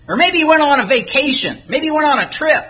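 high-pitched, mocking suggestions; taunting. Elijah mocks the prophets whose god won't answer, taunting. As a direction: needle them in a lifted, teasing pitch. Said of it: "Elijah began to taunt".

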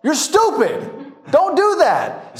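urgent stop to a reckless friend; alarmed warning. Retold or imitated. Tourist warning his friend away from the tiger.

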